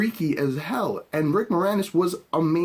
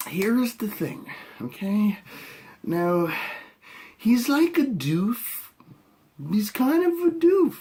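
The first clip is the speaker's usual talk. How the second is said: mocking; 6 semitones higher, slower than usual. Real, not acted. Mocking Hemsworth as a doof.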